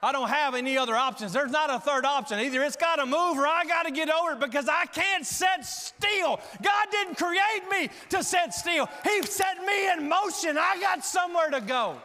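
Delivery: loud, high, building to a shout; full-force preaching. Acted. Preacher urging congregation to climb their mountain, full-force preaching.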